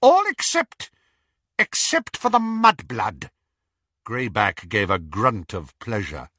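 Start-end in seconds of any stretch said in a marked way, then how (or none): 0.0-3.3 high, loud gloating witch. A narrator's reading. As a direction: relish the exception. Bellatrix voiced.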